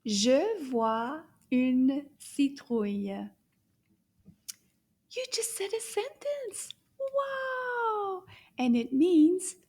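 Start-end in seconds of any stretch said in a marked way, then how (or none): 0.1-3.3 Je vois une citrouille said slowly and firmly; slow repeat. Shown as a demonstration. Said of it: "Can you repeat that?". 5.1-8.2 high, thrilled praise; excited praise. Real, not acted. Praising the learner's success.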